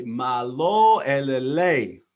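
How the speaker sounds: "Malo elele" very slow and loud, each syllable MAH-lo eh-leh-LEH; slow Tongan greeting. Shown as a demonstration. Teaching listeners the Tongan greeting, slow and clear. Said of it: "I'm gonna say".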